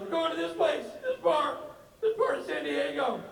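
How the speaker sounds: high, whiny repeat of the address; whiny Rob. Retold or imitated. Friend reading the address back, high and whiny. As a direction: parrot the address in a high whine.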